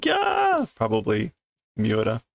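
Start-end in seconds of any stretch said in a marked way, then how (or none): 0.0-0.7 very high shrieked yeah; piercing childish scream; girl's scream. Acted. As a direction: shriek in panic. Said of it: "someone else is screaming".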